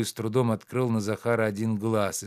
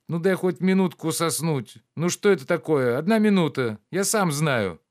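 higher, louder sleepy whining; whiny pleading; 7 semitones higher, 5 dB louder than usual. Acted. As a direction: beg for one more minute. Master begs for sleep. (капризно и громко выпрашивает минутку)

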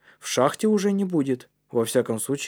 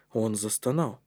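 ordinary delivery, nothing marked

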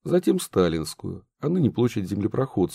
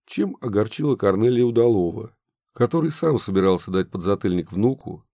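ordinary delivery

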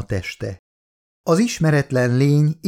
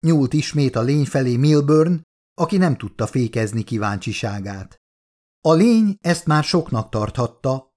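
usual speaking voice

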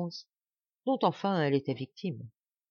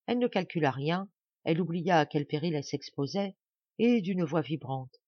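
plain reading voice

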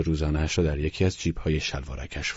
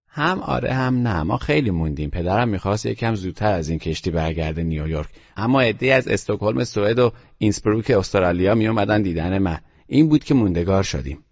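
put-on a calm, serious boy's voice, a little higher; boy Teddy, matter-of-fact; 5 semitones higher, 3 dB louder than usual. Teddy tells Nicholson about his European trip, in a calm, higher boy's voice. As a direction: voice the grave child reporting his trip.

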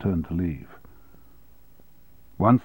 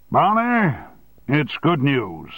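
boomed; 6 semitones higher, slower than usual. A narrator's reading. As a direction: boss booming the good news down the phone. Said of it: "he boomed".

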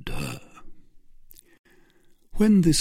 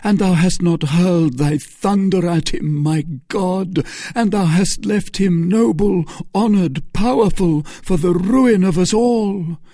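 high, loud outcry to God; Felton's outcry; 11 semitones higher, 5 dB louder than usual. Acted. Felton crying out to God. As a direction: call down thunder. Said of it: "cried Felton".

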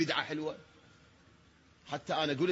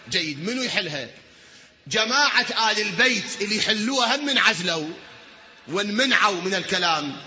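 louder and higher, building to a cry; rising preaching; 5 semitones higher, 7 dB louder than usual. Acted. Preacher asking the congregation who will solve it, building cry. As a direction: drive the point home to the crowd.

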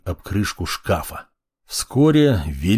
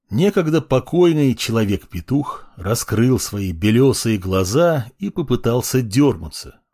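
usual speaking voice

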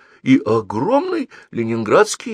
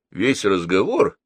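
ordinary delivery

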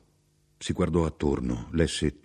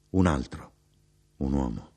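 ordinary delivery, nothing marked

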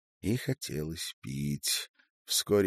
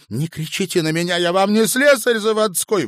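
loud, high-pitched angry retort; indignant; 14 dB louder than usual. A narrator's reading. Woman to man ordering her about, indignant. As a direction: shout back in outrage. Acted quarrel, woman snaps back.

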